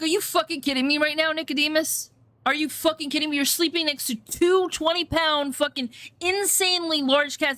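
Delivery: high, incredulous telling-off; exasperated scolding. Retold or imitated. To Nicodemus the owl sleeping between cats, incredulous scolding. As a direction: scold a pet you can't believe.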